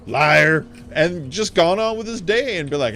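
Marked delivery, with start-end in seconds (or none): 0.1-0.6 loud, drawn-out mock insult; hammed-up angry accusation; mock scolding. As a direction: imitate a miner cursing a child. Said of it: "He could have just been like".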